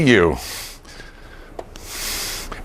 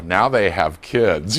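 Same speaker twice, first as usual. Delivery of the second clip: usual speaking voice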